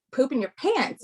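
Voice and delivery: ordinary talk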